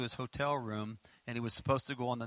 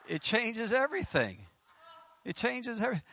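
higher, laughing through the words; can barely finish for laughing; amused; 8 semitones higher than usual. Real, not acted. Preacher joking about lint, breaking into laughter. Cracking up at own joke.

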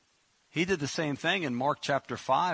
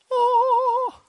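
1 mock swoon; 10 dB louder than usual. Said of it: "I don't think she went".

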